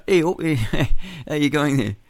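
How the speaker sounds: host acting out Jesus meeting fishermen at Auckland Wharf, in a mock accent; mock fisherman accent. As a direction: play the fishermen in a jokey put-on accent, lifted up high. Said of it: "I'm speaking in the wrong accent".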